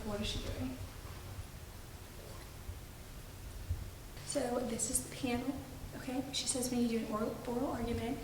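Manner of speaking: very soft, hushed voice; whispering on the phone. Real, not acted. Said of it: "I feel like I have to whisper".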